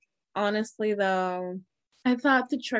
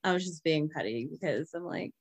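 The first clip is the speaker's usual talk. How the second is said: normal talking voice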